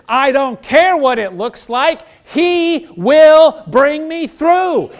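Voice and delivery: bold faith declaration. Acted. Preacher proclaiming faith to the congregation, loud and slow. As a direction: declare it loud, each word stretched. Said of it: "Faith says".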